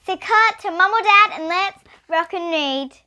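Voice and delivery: put-on bright, high, slow welcome to kids; sing-song greeting to children; kids' show host opening; cheery host intro.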